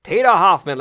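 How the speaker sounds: loud, high ring-announcer call; projected hammed-up name announcement; announcer's introduction. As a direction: present the guest with a big flourish. Announcing guest to audience.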